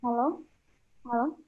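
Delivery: calling hello from far off the mic; barely audible. Real, not acted. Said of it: "sama suaranya terlalu kecil".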